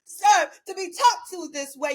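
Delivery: high, loud, tearful mock protest; indignant ex. Ex protesting to Simone, high and loud. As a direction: imitate him wailing in offended pride. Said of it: "he said".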